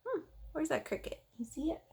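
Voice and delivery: usual speaking voice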